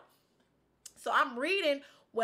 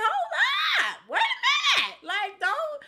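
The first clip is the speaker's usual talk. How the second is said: sudden shouted hold up, much higher and louder; indignant outburst; 7 dB louder than usual. Real, not acted. Woman shouting back at people doubting she can have kids. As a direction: cut them off loudly, refusing the bad news. Rejecting doom about her fertility.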